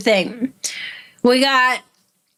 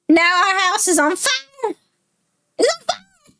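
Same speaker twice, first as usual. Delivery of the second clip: put-on high little kid voice, rising to panic at the end; a scared kid blurting out what happened; child's voice going high with fear; frightened child voice; 6 semitones higher than usual. Child tells of the killing.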